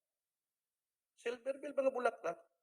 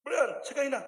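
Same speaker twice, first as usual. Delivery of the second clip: calling out; 10 semitones higher, 7 dB louder than usual. Retold or imitated. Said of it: "Sabi ni Malpa".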